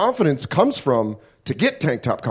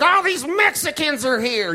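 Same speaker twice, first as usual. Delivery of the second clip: higher mock-angry local griping; mock high outraged Arizonan; angry sunburnt local; 11 semitones higher than usual. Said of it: "It's like".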